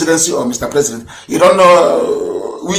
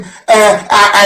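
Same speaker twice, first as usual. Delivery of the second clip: ordinary delivery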